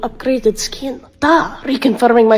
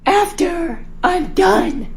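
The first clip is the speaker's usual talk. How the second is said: even slower, drawn-out defiance; defiant repeat; 4 semitones higher, much slower than usual. Acted. Daughter repeating her refusal to mom, slower and defiant. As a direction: drag each word out to provoke.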